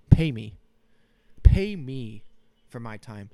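loud, punchy mock demand for money. Podcaster jokingly demanding money from streaming platforms, loud mock demand. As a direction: hit it hard, drawing it out the second time.

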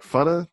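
"Funna" with an "uh", FUN-uh, pitched high as a question; rejected pronunciation. Shown as a demonstration. Southerner doubting the movie's word, "Funna" said as a high question.

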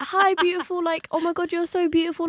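high, gushing auntie voice. Retold or imitated. Auntie gushing over her son's friend, high and sweet. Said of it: "she was like".

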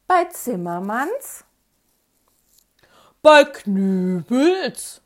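drawn-out, loud telephone greeting; hammed-up singsong phone pickup; playful phone answering. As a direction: answer the phone slowly with exaggerated, stretched names. Mock formal phone answering as a joke.

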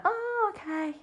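very high, soft "oh, okay"; mock amazement. Imitating a wowed viewer, high and breathy. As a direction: pretend to be impressed. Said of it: "it's nothing to be like".

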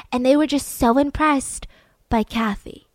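ordinary delivery, nothing marked

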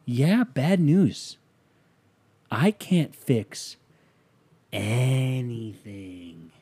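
very slow, stretching each word; drawn-out confession. Retold or imitated. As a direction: drag out the admission, lingering on the last word. Retold confession to partner, drawn out.

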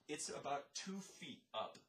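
normal talking voice

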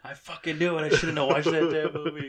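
loud, high comic exasperation; mock dying outburst. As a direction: cry out in pretend last-second regret. Acts out dying outburst, joking.

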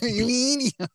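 high cartoon squeal; Ren voice. Cohost repeating the Ren yell, high. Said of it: "very much Ren".

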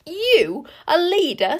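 high, loud, venomous jab; spat with scorn. A narrator's reading. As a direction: strike like an adder at her daughter. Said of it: "spat Queen Sycorax in a voice like an adder strike".

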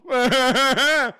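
high, loud burst of laughter; loud cackle. Real, not acted. Host laughs at his own gross joke, high cackle.

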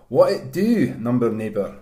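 hearty mock-Scottish greeting; put-on Scottish accent. Greeting a number neighbour, put-on Scottish accent. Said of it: "I'm gonna do Scottish for mine".

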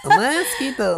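high-pitched mock Japanese squeak; mock Japanese accent. Boyfriend clowning with the word, high mock Japanese accent. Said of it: "That sounds so Japanese".

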